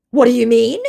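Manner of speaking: high, loud rising question; hurried scammer. Acted. Fake Tim challenged on being Aussie, mock fluster. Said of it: "he hurriedly puts in".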